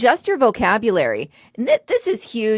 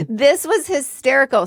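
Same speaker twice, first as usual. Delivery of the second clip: talking through real laughter, higher and louder; giggling as she starts the story; laughing; 5 semitones higher, 4 dB louder than usual. Real, not acted. Host laughing while telling the audience about Justine.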